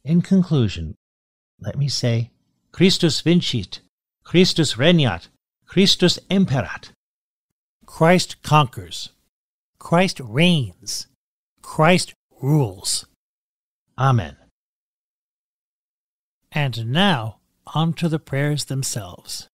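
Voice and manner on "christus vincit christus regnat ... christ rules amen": measured, solemn acclamation, slow in places; prayer recitation. Acted. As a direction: proclaim it like a closing prayer.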